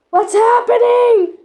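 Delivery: alarmed shout. Pretend. Toy passenger startled by crash.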